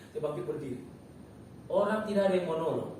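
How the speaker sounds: ordinary talk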